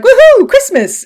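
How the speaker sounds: high, loud mock cheer; gleeful whoop. Agent finding a pile of stolen tech, gleeful whoop. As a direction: whoop like a kid getting presents.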